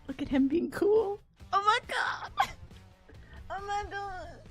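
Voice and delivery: high-pitched swooning squeal; gushing fangirls. Acted. Fans swoon over a character being cool, squealing. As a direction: squeal over him, hammed up. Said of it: "we're like".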